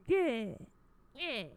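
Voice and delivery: put-on New York tough-guy yeah; tough New York accent. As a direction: toss it off like a tough New Yorker. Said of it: "this, like, New York".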